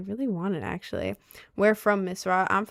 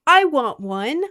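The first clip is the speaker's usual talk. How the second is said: higher and louder, pleading; eager whine; 4 semitones higher, 8 dB louder, slower than usual. Real, not acted. Streamer begging chat for a grimoire. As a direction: beg for it. Repeat, high loud.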